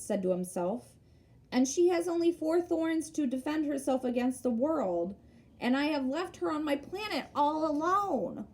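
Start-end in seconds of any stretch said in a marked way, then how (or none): 1.5-8.5 put-on lightly raised, innocent boy's voice; a gently voiced small prince, simple and earnest; little prince. A narrator's reading.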